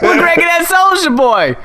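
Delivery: put-on hyped kid. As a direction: chant it like an excited kid. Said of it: "kids answer in the video".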